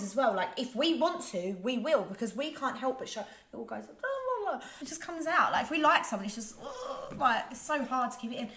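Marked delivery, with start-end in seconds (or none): 3.9-4.7 high sing-song blah blah. Pretend. 6.6-7.2 high frustrated ugh; exasperated. Pretend.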